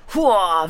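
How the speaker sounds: loud battle cry; rallying shout. Acted. Streamer rallying chat, loud battle cry. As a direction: bellow a short war cry.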